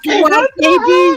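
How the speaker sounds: loud, high, drawn-out call; calling back. Real, not acted. Woman answers someone off to the side, calling out loudly. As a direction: holler it slowly to someone away.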